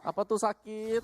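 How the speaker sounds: high, slow, amazed question; drawn-out surprised ask. Real, not acted. Co-caster reacts to a hit.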